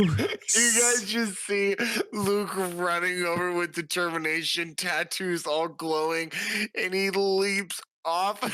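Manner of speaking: laughing excitedly while describing the leap. Real, not acted. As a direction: giggle through an eager play-by-play. Player laughing narrating own leap.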